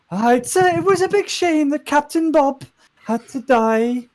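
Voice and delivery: high, loud singsong Welsh speech; solidly Welsh. Acted. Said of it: "she's solidly Welsh now".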